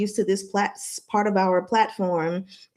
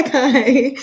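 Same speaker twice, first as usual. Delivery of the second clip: normal talking voice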